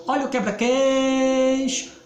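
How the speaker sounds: high singsong call, "quei" stretched long; vendor's cry. Retold or imitated. Teacher imitates the quebra-queixo seller's cry for the class. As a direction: sing it out like the candy seller. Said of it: "imitando o vendedor de quebra-queixo".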